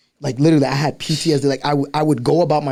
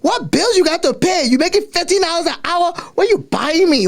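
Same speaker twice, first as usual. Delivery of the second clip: high-pitched scolding mom impression; mother's voice; 3 dB louder than usual. Mom challenging her earning child, indignant scolding. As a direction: imitate an outraged mother, pitched way up. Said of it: "my mom's like".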